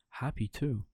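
usual speaking voice

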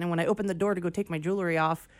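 ordinary talk

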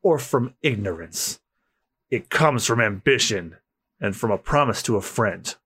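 low, resolute character voice; grave swordsman. Acted. Swordsmen weighing courage and ambition, grave. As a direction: speak low and solemn before the duel.

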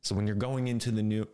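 usual speaking voice

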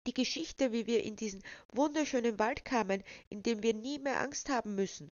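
put-on high, asking child voice; pleading little girl. A narrator's reading. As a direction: ask sweetly like a little girl. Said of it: "bat Walda".

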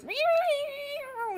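high, loud yowling meow; imitated cat yowl. Cat stepped on in the kitchen, high loud yowling meow. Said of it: "And all you hear is".

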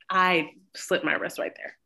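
ordinary talk

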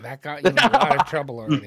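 fast, loud scolding. Real, not acted. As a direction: rattle off a loud rebuke. Parent scolding child.

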